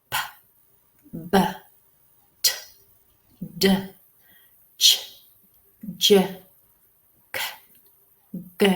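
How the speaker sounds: isolated consonant sounds, not letter names; each sound alone, a pause after each; consonant demonstration. Shown as a demonstration. Teacher reading the consonant chart aloud, isolated sounds. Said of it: "we're going to read through".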